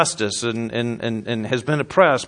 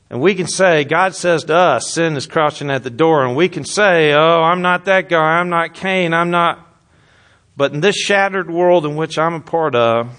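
loud, driving sermon delivery; louder pulpit voice, pressing hard; full-force preaching; 3 semitones higher, 4 dB louder than usual. Acted. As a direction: preach it out to the congregation.

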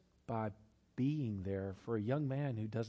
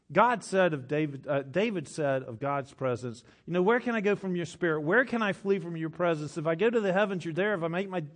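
louder and higher, climbing toward a peak; building preaching; 4 semitones higher, 7 dB louder than usual. Acted. Preacher reciting the psalm to the congregation, building loud and high.

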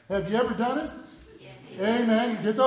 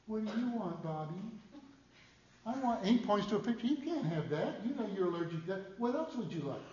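put-on softer, patient mom talking to her kids; hushed mother voice; 3 semitones higher, 10 dB quieter than usual. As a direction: gently coax a child to choose.

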